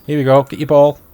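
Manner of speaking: high, loud call to the dog; excited dog call. Real, not acted. Player calling a dog to fetch the ball, high and loud. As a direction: get the dog going, bright and loud.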